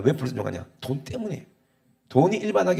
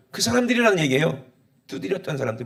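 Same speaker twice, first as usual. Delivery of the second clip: ordinary talk, nothing marked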